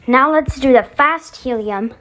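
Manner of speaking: ordinary delivery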